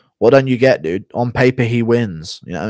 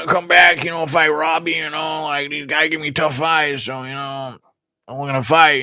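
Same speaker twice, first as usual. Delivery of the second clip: higher, rambling put-on drone; Nick Diaz impression; 6 semitones higher than usual. Nick Diaz talking about fighting Robbie, higher halting drone. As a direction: ramble in a raised monotone, filler after filler. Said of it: "Nick Diaz".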